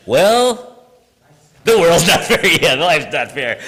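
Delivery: high, loud, snarky retort. Retold or imitated. Teacher giving students a snarky comeback. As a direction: toss it back with a laughing smirk. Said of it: "My snarky response is".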